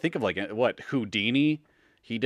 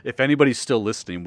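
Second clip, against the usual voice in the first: ordinary delivery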